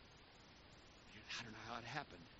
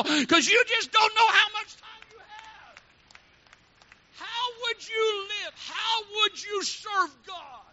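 higher, slow, crying shout; tearful preaching cry; 13 dB louder, slower than usual. Acted. Preacher pleading through tears about limited time, slow crying shout. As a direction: let the voice break with pleading.

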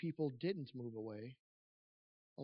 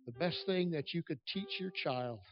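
building, impassioned preacher over music; fervent preaching; 3 semitones higher, 4 dB louder than usual. Real, not acted. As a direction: preach it to the rafters.

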